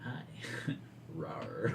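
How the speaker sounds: low playful rawr growl; bouncy playful with animal noises. Pretend. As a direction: tease with a cute growl. Playful teasing on stream.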